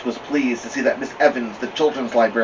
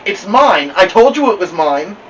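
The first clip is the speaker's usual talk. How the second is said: higher, louder crowing; triumphant; 7 semitones higher, 8 dB louder than usual. Acted. Little girl crowing to her sister over the book, louder. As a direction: crow over the big sister.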